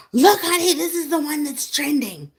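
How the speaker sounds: high and loud, pushy; mock urgent wife. Wife to husband late at night, high loud mock urgency. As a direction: shove the phone at him, playful.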